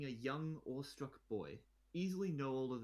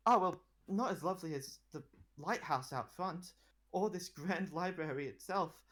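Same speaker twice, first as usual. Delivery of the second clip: a lightly voiced excited teenage boy, a touch higher; stammering fan meeting his idol; nervous boy; 7 semitones higher, 5 dB louder than usual. Boy applicant blurts out to the queen, lightly voiced and excited.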